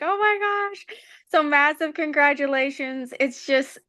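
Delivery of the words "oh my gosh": high, breathless-sounding reenacted surprise; retold gush of surprise; amazed oh my gosh. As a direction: replay the moment the news landed, pitched up. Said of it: "I'm like".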